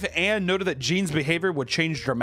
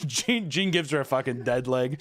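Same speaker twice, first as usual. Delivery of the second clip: ordinary talk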